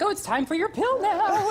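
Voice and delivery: high singsong caregiver voice; imitating an overeager mother; doting mom. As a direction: coo it up high like a delighted nurse-mom. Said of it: "my mom was in heaven taking care".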